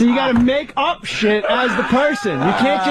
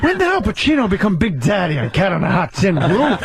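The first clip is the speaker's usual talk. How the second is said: hammed-up movie-star impression; Al Pacino; 2 semitones lower than usual. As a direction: loose caricature of a theatrical film actor. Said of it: "You wanna call Al Pacino".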